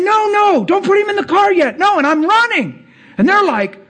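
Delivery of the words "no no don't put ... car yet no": emphatic pleading shout. Retold or imitated. Running to officers, urgently begging them to wait. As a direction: shout it high and insistent while running. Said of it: "I'm real emphatic".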